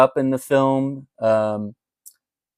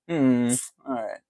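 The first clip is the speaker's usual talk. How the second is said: imitated unenthused barista; 6 dB quieter than usual. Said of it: "always a bright and cheery one, but then".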